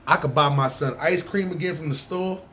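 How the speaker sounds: ordinary talk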